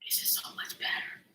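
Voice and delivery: soft whispered aside. Real, not acted. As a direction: breathe it out as a secret. Whispered aside while cooking.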